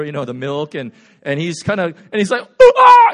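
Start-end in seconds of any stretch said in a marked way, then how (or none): 2.6-3.1 loud, high shriek of shock; startled yelp. Retold or imitated. Servant seeing the army outside, startled shriek. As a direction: yelp as if you just saw the army. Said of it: "He's shocked".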